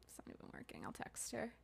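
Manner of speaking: hushed whisper aside. Real, not acted. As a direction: whisper so the caller can't hear. Aside while on the call.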